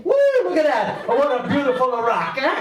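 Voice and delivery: high, loud gleeful admiration; mock delighted. Cook admiring his crack rock, high and gleeful. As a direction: gush over it like a proud cook. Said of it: "it's like".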